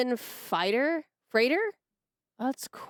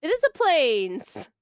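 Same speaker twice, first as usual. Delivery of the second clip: excited; 4 semitones higher, 6 dB louder than usual. Real, not acted. The wanted card turns up.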